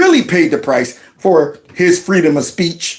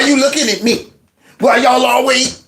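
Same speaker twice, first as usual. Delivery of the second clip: lightly voiced indignant victim, rising higher to a mock yell; mock whiny victim; 6 semitones higher than usual. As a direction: play the fake-outraged victim. Voicing her as the whiny victim.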